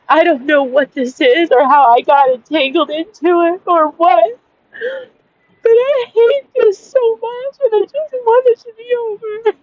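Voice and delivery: high, strained, near tears; real distress, the voice cracking as it climbs; voice breaking. Real, not acted. Venting to viewers about being homeless, voice breaking up. Despair at the end of the rant.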